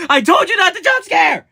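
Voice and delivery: high panicked yell; startled shout. Real, not acted. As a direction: shout out in sudden fright. Scared again by the blower.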